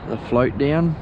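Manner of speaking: usual speaking voice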